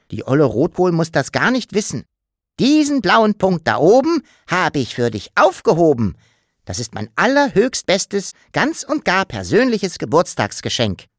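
playful little creature voice; the Sams. Acted. Sams wishes Papa happy birthday, cheeky.

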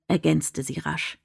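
usual speaking voice

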